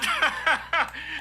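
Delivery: short delighted laugh; high burst of laughter. Real, not acted. Host laughing at the politician getting caught. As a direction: laugh at the blunder.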